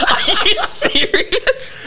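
high, slow, dissolving into laughter; can barely get the words out for laughing; laughing disbelief. Real, not acted. Disbelief at rude passenger.

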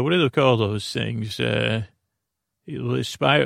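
usual speaking voice

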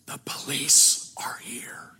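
put-on slow, secretive little boy whisper; child's whisper. Little boy whispering to the salesman on the phone. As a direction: breathe it like a kid hiding something. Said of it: "And the little voice said".

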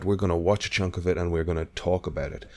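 usual speaking voice

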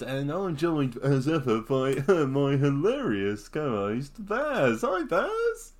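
hammed-up radio host introducing a co-host; swooping high-pitched presenter patter. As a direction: play the cheesy radio personality. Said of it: "You sound like Chris Evans".

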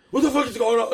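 mock screaming. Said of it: "He's like".

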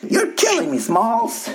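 put-on high squeaky kid voice; Sandlot kid impression. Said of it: "the dude in Sandlot".

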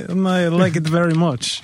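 a hint of a Russian accent; mock Russian accent. To the songwriter, still in mock Russian accent. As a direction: keep the compliment going in a put-on Russian accent. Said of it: "Is that a Russian?".

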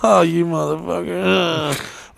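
high, drawn-out wail of exasperation; laugh-tinged high groan, stretched out; mock dismay. Guest reacting to friend's announcement before cameras, mock dismay.

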